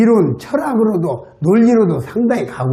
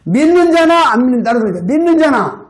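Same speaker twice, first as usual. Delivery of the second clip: call-and-response preaching; 9 semitones higher than usual. Acted. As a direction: call out each phrase for the church to echo. Preacher leads congregation repeating.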